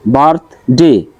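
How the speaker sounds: বার্থডে split as বার্থ, ডে; two separate beats on বার্থডে; syllable by syllable. Shown as a demonstration. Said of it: "দুইটা দেওয়া আছে".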